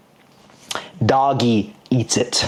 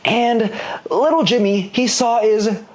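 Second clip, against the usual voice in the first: put-on little boy voice; 6 semitones higher than usual. Story voicing Little Jimmy.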